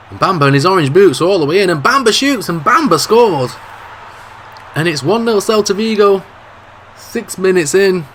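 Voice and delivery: excited goal call. Acted. Commentator calling Bamba's goal, excited rising shout. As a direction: sell the goal with a rising roar.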